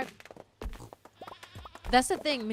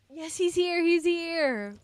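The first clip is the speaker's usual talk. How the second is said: high, loud excited greeting; excited squeal; 10 semitones higher, 6 dB louder, much slower than usual. Real, not acted. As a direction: squeal with delight at the dog. Greeting her dog excitedly.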